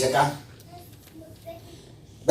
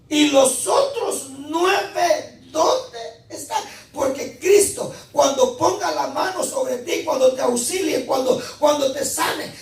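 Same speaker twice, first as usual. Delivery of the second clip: prédica a plena voz; 12 semitones higher than usual. Real, not acted. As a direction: proclaim it to the congregation at a raised pitch. Preaches at full force. (predicación encendida, voz alta y enérgica)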